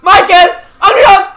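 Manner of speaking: loud, high urging; excited shouting. Real, not acted. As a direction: yell the player on. Shouts at attacking play. (grito de aliento)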